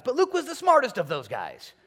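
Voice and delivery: Luke hit high and loud; stress on Luke. Shown as a demonstration. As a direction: punch Luke, playful reveal. Joking denial then reversal.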